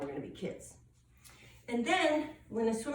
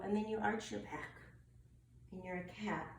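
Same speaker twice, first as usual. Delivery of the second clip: ordinary delivery, nothing marked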